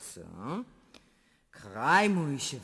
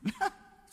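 krótki śmiech. Acted. Empress laughs before speaking.

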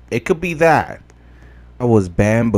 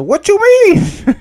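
very high, loud, drawn-out protest; indignant disbelief; 9 dB louder than usual. Real, not acted. Player told to keep training, indignant. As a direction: squeal it in baffled outrage. Objects to game's advice.

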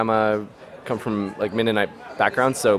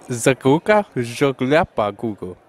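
mock German gibberish; 4 dB louder, slower than usual. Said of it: "what about a normal German".

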